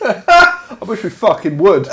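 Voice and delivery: slow, loud and high, every word pushed out; a mock-exasperated loud outburst; emphatic outburst. Real, not acted. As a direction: blurt the wish out, loud and slow. Joking exasperation about the dog.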